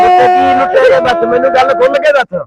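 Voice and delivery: father loudly pressing his crying daughter; alarmed shouting. Acted. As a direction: shout at her to explain and stop crying. Father demanding what happened.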